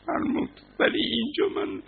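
ordinary talk, nothing marked

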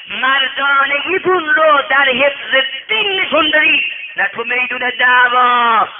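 loud, high exhortation from the pulpit; impassioned shouted sermon; full-force preaching. Real, not acted. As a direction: thunder it out to the congregation.

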